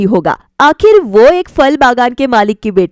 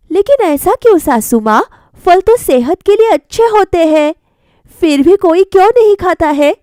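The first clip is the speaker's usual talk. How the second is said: higher and louder, pressing the question; insistent young wife; 7 semitones higher, 5 dB louder than usual. Acted. Bride pleads with mother-in-law over fruit, higher pressing voice. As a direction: push back, voice rising with urgency.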